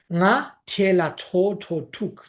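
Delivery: very slow, each syllable of γα τιέλα τσο τσο τουκ apart; slowed teacher demo of a hard phrase; slow model phrase. Shown as a demonstration. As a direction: say γα τιέλα τσο τσο τουκ slowly so learners copy it. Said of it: "είναι λίγο δύσκολο να το πείτε".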